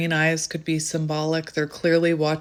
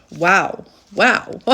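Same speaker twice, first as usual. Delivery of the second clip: louder stunned wow and laugh; amazed; 5 semitones higher, 6 dB louder than usual. Real, not acted. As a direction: marvel then burst out laughing. Surprising card.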